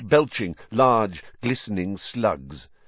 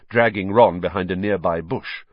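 ordinary delivery

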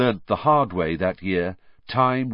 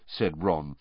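usual speaking voice

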